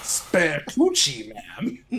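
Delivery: slow villain voice; drawn-out cartoon villain line; Robotnik voice. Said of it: "It's not even a good Robotnik voice".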